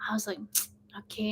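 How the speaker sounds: soft whispered okay. Retold or imitated. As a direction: breathe it out quietly. Whispered thought at night.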